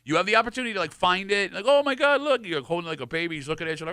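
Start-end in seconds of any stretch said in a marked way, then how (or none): 1.7-2.4 higher and louder, a playful fake gasp of discovery; mock excited. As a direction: pretend you just spotted it, pitch jumping up. Imagined finder spots the wallaby.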